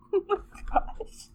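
normal talking voice

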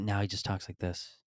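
soft-spoken imitation. Comic mimicking a reformed guy, softly. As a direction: drop the volume to imitate his new manner. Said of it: "And he talks like this".

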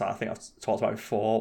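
ordinary talk

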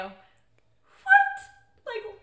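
very high-pitched, stunned squeak; real high yelp of astonishment; incredulous squeal. Real, not acted. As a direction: squeak in total disbelief. Incredulous at the romantic gift.

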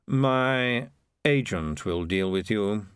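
slow, drawn-out dismissal; dismissive piper. Acted. Piper dismisses the mayor, slow and disdainful. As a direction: brush them off at leisure.

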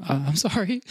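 high, small-voiced imitation of apologizing; sheepish mock apology. Fan retells being told to calm down, sheepish apology. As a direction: play a meek fan caught overdoing it.

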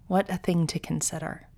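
usual speaking voice

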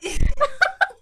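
sudden high laugh; high burst of laughter. Real, not acted. Laughing at her friend's story, a high sharp laugh.

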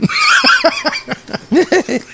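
long, high cackle; laugh. Real, not acted. Laughing after the "pa'l cruce" joke. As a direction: laughs hard at his own joke.

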